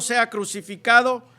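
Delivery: loud scripture quote of a shouting crowd; crowd's shout, narrated. A narrator's reading. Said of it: "ellos gritaban aún diciendo". (grito de la multitud, leído con fuerza)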